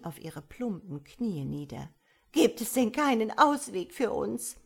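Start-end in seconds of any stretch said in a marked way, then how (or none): 2.3-4.6 loud, high-pitched plea; desperate. A narrator's reading. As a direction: beg the devils for a way out. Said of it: "mit blanker Verzweiflung". (verzweifelt flehend)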